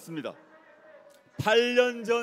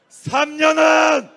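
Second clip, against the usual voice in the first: high, loud, drawn-out call to the crowd; slogan shout; 4 semitones higher, 5 dB louder, slower than usual. Acted. Orator prompting supporters with the party slogan, slow shout. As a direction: stretch it out so the crowd can finish it. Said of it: "뭐라고 외쳤습니까".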